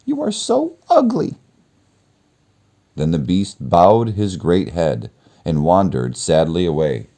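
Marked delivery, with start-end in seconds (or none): 0.1-1.3 put-on far higher, blunt girlish voice; very high girl's voice. Beauty telling the beast he is ugly, very high.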